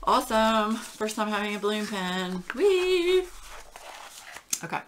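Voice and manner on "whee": high, loud squeal of delight; gleeful whee. Real, not acted. Collector thrilled by a first balloon pen, gleeful squeal. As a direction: let out a long, high "whee!".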